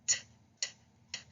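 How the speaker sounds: short unvoiced taps, t t t; tapping T sound. Shown as a demonstration. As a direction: tap the tongue, t. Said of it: "So I notice with T".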